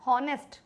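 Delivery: "हॉनेस्ट" with an audible H at the start; H sounded. Shown as a demonstration. Teacher shows students a misreading, "हॉनेस्ट" with H voiced. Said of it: "अगर कोई इसको हॉनेस्ट पढ़ रहा है".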